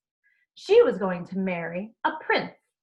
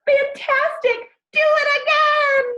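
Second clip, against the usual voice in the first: much higher, loud delighted shout; 8 dB louder than usual. Acted. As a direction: cheer the dragon on. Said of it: "But Elizabeth shouted".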